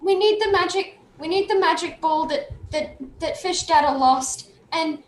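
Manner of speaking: put-on high, earnest little-kid voice; pleading child character. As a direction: implore the grown-ups like a small child. Young Willen pleads for the ball.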